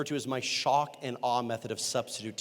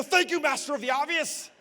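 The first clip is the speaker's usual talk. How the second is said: much higher sarcastic thanks; sarcastic comeback; 9 semitones higher than usual. Retold or imitated. Sub answering the smug student, sarcastic and high. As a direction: thank him with mock sweetness.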